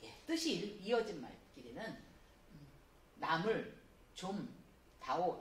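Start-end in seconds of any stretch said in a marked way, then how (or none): 3.2-5.4 slow, with 남을 kept whole as one group; phrased reading demo. Shown as a demonstration. Teacher reading slowly to show words grouped by meaning. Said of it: "이렇게 묶을 건 묶고".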